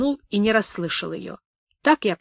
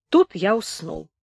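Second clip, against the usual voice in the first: ordinary delivery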